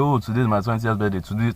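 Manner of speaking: normal talking voice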